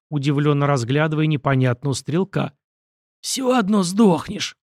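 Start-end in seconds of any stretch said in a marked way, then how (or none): 3.2-4.6 slow, higher, hoarse wheezing; hoarse wheeze. A narrator's reading. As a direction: rasp it out slowly through the pain. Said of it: "просипел стрелок".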